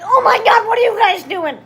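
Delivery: high, loud shocked gasp. Retold or imitated. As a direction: catch them in the act, loudly. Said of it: "she's just basically like".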